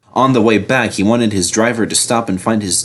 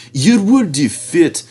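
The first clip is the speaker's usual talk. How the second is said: oily Diesel; 9 semitones higher than usual. A narrator's reading.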